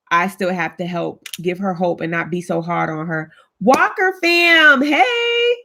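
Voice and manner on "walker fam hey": high, drawn-out hello; excited greeting. Real, not acted. Host greeting a viewer family, stretched high call. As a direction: sing out a big welcome.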